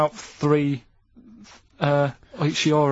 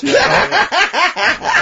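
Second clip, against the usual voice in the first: high squealing laugh on the word; big laughing right, voice jumping high; 9 dB louder than usual. Real, not acted. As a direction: crack up laughing as you ask. Laughing punchline to friends.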